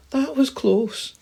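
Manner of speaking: very high, loud, slow mouse voice; relieved mouse. Acted. Mouse to hamster after escaping, high and relieved. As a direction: squeal it out, stretched with relief.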